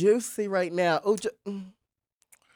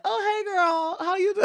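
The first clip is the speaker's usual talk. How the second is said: friendly greeting; 10 semitones higher than usual. Retold or imitated. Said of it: "it's always, like, a fun little".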